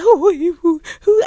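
high, loud panicky who-who; scared stammer. Retold or imitated. Voicing the scared girlfriend caught by the angry brother, panicky. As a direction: squeak it out like a cornered woman. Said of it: "She looking all scared and she like".

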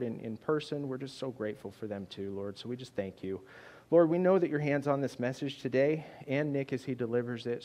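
soft, earnest prayer voice. Acted. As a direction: pray quietly and sincerely. Leads the congregation in prayer.

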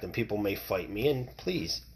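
ordinary talk, nothing marked

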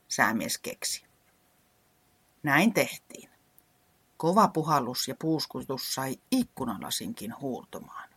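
ordinary delivery, nothing marked

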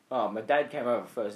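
normal talking voice

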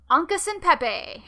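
high, loud relish of the name; playful relish. Shown as a demonstration. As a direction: belt out the name with playful flourish. Relishes the name, then apologises.

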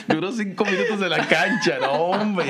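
high laugh running into the words. Real, not acted. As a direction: laugh through the line. Laughing while telling.

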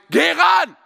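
high, full-force touchline yell; loud, high-pitched sports-parent shout; sideline dad screaming. Retold or imitated. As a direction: scream at your kid from the sidelines. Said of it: "und schreit".

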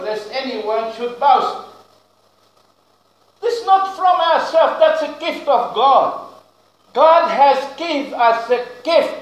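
ordinary talk

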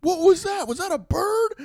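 high, loud puzzled voices; mock confused crowd. Campers puzzled by the thrown ice cube, high loud. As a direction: ham up the bewildered onlookers. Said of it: "everybody's like".

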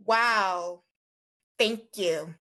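ordinary talk

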